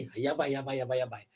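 soft worried yabai; muttered yabai. Shown as a demonstration. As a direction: mutter under breath. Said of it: "tak boleh jadi ni".

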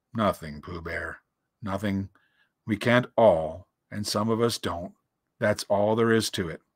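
low, resigned donkey voice; gloomy Eeyore. A narrator's reading. Donkey telling Pooh Bear it is nothing, gloomy. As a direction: let it sink low and downcast. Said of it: "said Eeyore gloomily".

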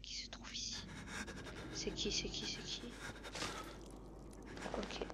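scared hushed whisper. Real, not acted. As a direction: whisper so nobody hears. Hiding from a pursuer.

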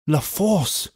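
softly voiced, dismayed repeat; grave echo. A narrator's reading. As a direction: echo the dreaded name under dismay. Lorry echoes the prison name.

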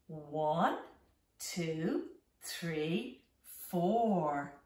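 usual speaking voice

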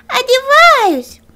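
very high, loud, slow answer; frightened fox. Acted. Fox calls down from the stove, very high and loud. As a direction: squeal it like a scared fox.

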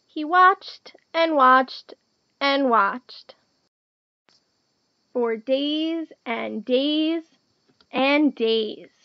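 very slow, drawn-out storytelling; long, patient waiting. A narrator's reading. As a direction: stretch each repetition to show time dragging.

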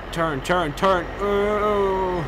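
loud, high pleading turn; frantic loud commands; urgent yelling. Real, not acted. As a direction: shout at it to turn. Steering a runaway vehicle.

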